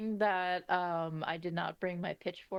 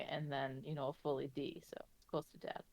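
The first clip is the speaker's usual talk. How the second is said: whispering; 6 semitones lower, 8 dB quieter, faster than usual. Real, not acted. Joke muttered aside.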